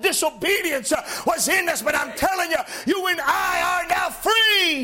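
rising, shouted gospel preaching; preaching at full force. Acted. Preacher to his congregation, shouting, fervent. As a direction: lift the congregation with fiery, shouting delivery. Preacher rises again on liberty.

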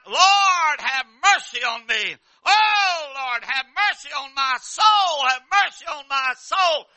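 loud, slow, high wailing plea; crying out to God. Acted. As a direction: cry out for mercy from the depths. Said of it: "he cried out".

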